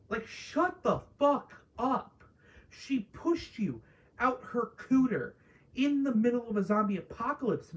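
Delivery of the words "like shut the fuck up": exasperated. Real, not acted. Exasperated outburst at character.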